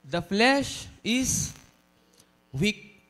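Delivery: the flesh is dragged out very slowly, then weak; slow dramatic reading. Shown as a demonstration. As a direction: read it out slowly with weight. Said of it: "nakalagay sa kasunod na verse".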